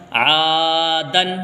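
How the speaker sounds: آدن with a long stretched آ; drawn-out aa. Shown as a demonstration. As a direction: hold the آ in آدن long, then دن. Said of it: "یہاں پر آ کھینچے گا".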